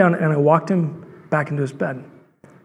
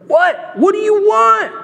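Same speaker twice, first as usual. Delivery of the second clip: high, loud exasperated yell; shrill-pitched outburst at being bothered; exasperated cry; 6 dB louder, slower than usual. Acted. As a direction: cry it out high, drawing out the end. Acted exasperated Eli, woken again.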